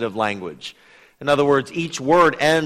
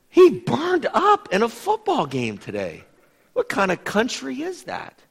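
higher, puzzled mock pity; mock literal reading; 8 semitones higher than usual. Preacher mocks a literal translation with puzzled pity. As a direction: play the baffled foreigner taking it literally. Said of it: "translate that literally".

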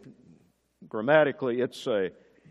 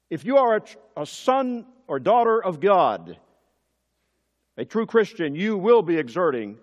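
louder, higher pulpit preaching; fervent sermon climax, raised and loud; preaching at full force; 3 semitones higher, 4 dB louder than usual. Acted. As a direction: press the point home to the congregation.

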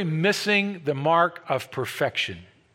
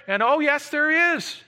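much higher, ringing affirmation; emphatic yes; 10 semitones higher than usual. Real, not acted. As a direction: answer with certainty and fire. Emphatic answer to objection.

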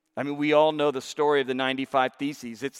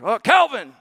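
eager shouted answer, higher; a student blurting out Calvin; student calling out; 5 semitones higher, 5 dB louder than usual. Retold or imitated.

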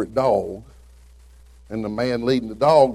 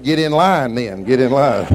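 punchline shout; 3 semitones higher, 8 dB louder than usual. Retold or imitated. Punchline of a joke.

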